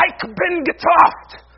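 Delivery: loud, high, slow bellow; furious German yell. Acted. Luther throwing his ink pot at temptation, furious yell. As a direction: yell it as if hurling something at the wall. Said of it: "throw it against the wall and yell".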